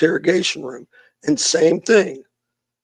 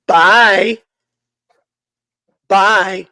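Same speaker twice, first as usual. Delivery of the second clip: higher, louder sing-song goodbye; mocking bye; 4 semitones higher, 7 dB louder than usual. Women mocking him as he passes, higher sing-song. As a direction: tease him on the way out.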